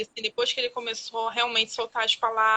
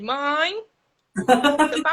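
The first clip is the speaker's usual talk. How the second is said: louder, higher child's call, imitated; son calling mom; 4 semitones higher, 6 dB louder, much slower than usual. Mother imitating her son calling grandma, louder and higher. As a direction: call out like a little boy looking for grandma. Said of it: "Mudou a entonação".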